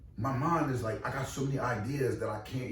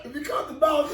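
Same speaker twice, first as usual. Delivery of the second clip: much higher and louder, hyped; pitched-up, loud emphatic restatement; fired-up repeat; 7 dB louder than usual. Real, not acted. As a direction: shout the point home with rising excitement. Repeats line, voice jumps up.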